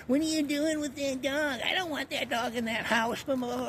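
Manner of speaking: high mock nagging woman's voice; imitating the female landlady's complaints, high and nagging.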